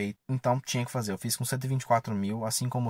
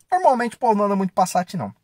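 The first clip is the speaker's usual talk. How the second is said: exclaimed; 9 semitones higher, 7 dB louder than usual. Real, not acted. Exclaiming about Passat rarity.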